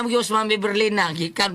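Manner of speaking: usual speaking voice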